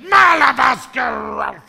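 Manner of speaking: huge drawn-out yell, high and loud. Retold or imitated. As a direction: bellow it slowly, stretching every sound. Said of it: "You're yelling".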